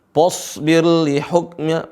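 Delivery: "Fasbir" chanted as "posbir"; slow demo of the wrong "posbir"; mistaken heavy fa. Shown as a demonstration. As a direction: round "Fas" into "pos" in "Fasbir li hukmi". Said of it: "teman-teman bacanya po".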